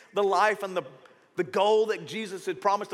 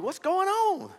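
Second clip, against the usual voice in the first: concerned question; 12 semitones higher, much slower than usual. Retold or imitated. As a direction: ask what's wrong as you walk up. Pastor approaching the crying woman.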